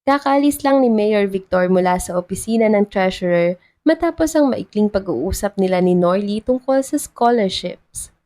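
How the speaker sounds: ordinary delivery, nothing marked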